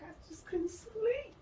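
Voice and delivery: high, slow, pleading mock whine; plaintive excuse. Explaining to the doctor, high plaintive whine. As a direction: draw it out high like a helpless confession. Retold plea to her doctor.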